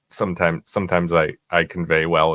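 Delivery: ordinary talk, nothing marked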